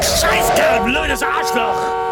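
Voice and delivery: furious high shout of abuse; angry cursing. Acted. Driver curses another car in anger. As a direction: curse him out loud.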